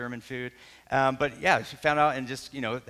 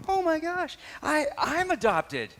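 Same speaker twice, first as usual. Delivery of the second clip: high, slow, stunned gasp of discovery; shocked realisation; slower than usual. Retold or imitated. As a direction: let the truth hit you mid-sentence. Realising he is adopted.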